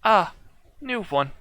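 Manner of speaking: high, pleased machine voice; robot medic. Acted. Warforged spotting the new party member, high robotic voice. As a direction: notice a newcomer like a curious robot. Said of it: "turns to Epos and is like".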